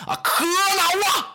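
a high, drawn-out cry of rage; furious outburst. Acted. Lü Bu slamming the table at the feuding generals, enraged cry. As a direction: howl it out slowly as the table is struck.

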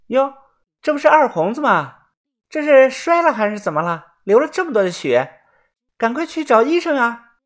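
high-pitched shocked villager; feigned alarm. Acted. Narrator pretends to discover the bleeding Erhongzi, high alarmed voice. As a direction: exclaim in put-on surprise and concern.

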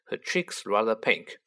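usual speaking voice

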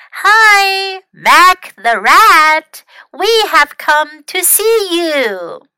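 calls out loud to the cabin; a slow, loud call; shouting. A narrator's reading. Tim calling to the rat through the door, loud. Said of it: "and he shouted".

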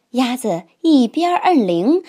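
ordinary delivery, nothing marked

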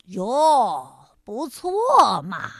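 low, slow sarcastic drawl; mock praise, dripping irony. A narrator's reading. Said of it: "还阴阳怪气儿地说". (阴阳怪气的低沉语调)

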